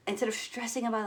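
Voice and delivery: ordinary talk, nothing marked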